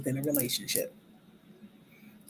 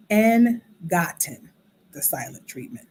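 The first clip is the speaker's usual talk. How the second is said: ordinary delivery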